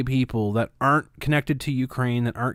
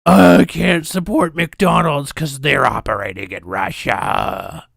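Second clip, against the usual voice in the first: slow, loud mocking whine of a virtue-signaller; hammed-up self-pitying boycotter; mocking whiny voice; 5 semitones higher, 8 dB louder, slower than usual. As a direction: sneer it out, drawn out and loud. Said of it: "be like".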